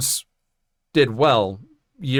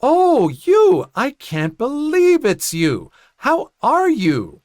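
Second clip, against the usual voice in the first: much higher, fake-delighted gush, slow at the end; loud high mock gush of surprise; exaggerated exclamation; 12 semitones higher, 4 dB louder, slower than usual. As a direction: ham up a teacher's false delight. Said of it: "she exclaimed".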